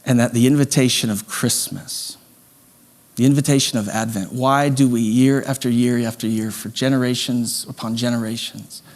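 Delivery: soft, slow, tender sermon close; hushed heartfelt invitation; quiet reflective preaching. Real, not acted. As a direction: draw them in gently with long pauses.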